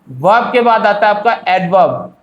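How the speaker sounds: ordinary talk, nothing marked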